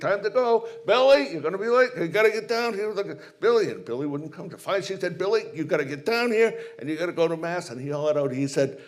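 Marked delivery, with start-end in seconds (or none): 0.0-3.7 put-on high, louder mother calling the kids; nagging mom. Mother calling her kids to breakfast before mass, high and nagging. 5.2-7.7 nagging mom. Retold or imitated. Mother calling her kids to breakfast before mass, high and nagging.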